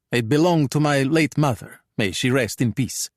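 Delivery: put-on Italian-accented English; pronounced Italian accent. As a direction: speak like a Calabrian thug trying his English. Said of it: "pitch-perfect Italian accented English".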